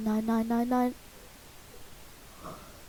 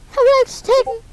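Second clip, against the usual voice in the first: excited repeat to buddy; 9 semitones higher than usual. Real, not acted. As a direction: exclaim it in disbelief.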